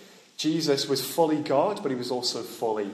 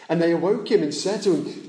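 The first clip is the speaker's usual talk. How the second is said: ordinary talk, nothing marked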